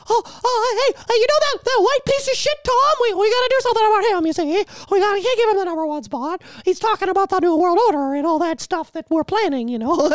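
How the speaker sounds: very high scheming cartoon mouse; fast sneaky squeak; Mickey Mouse voice. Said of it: "I picture little".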